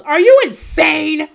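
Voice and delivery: high, loud incredulous yell; imitating Thomas yelling; mock yell. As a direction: shriek it in disbelief. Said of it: "And Thomas yells".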